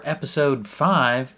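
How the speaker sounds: slow southern drawl, episode five stretched out; slow Southern twang on episode five; southern accent. Real, not acted. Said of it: "I don't know why I'm speaking in a southern accent".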